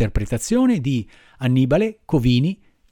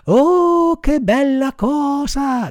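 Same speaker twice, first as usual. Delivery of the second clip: very high, drawn-out cry of delight; overjoyed cry; 13 semitones higher, 5 dB louder, much slower than usual. A narrator's reading. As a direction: burst out high, stretching every word with glee. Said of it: "gridò Pinocchio saltando dall'allegrezza". (grido acuto di gioia, parole allungate)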